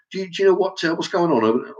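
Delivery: put-on high, cheeky kid's question; mock rude kid. Kid demanding answers from a stranger: high mock cheek. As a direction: ask like a cocky street kid. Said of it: "it's just like".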